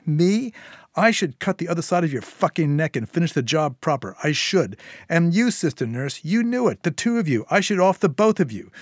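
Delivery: furious Syl. Acted. Syl turning on Declan for betraying her, furious. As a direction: berate them both, pitch staying high.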